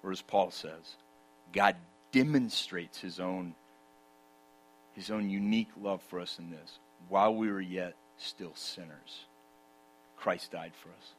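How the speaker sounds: soft, low and intimate, drawing them in; hushed preaching. Acted. Preacher drawing the congregation close, hushed and low. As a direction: let the voice drop right down, tender and near. Hushed turn to God's love.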